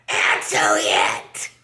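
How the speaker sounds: hissed order. Retold or imitated. Person at home hissing at Larry to answer. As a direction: hiss it through clenched frustration.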